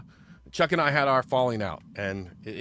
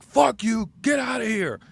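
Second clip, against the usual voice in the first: imitating a yell, pitched much higher and louder; retold angry snap; 10 semitones higher, 4 dB louder than usual. Chuck throwing his bandmate out, mock angry yell. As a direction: bark it like a furious bandmate throwing you out. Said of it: "he snapped".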